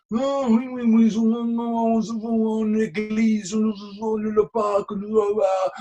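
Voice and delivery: high, slow, rapid-fire French directions; French guy. Retold or imitated. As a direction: play the baffling local rattling off directions. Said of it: "this French guy sort of went".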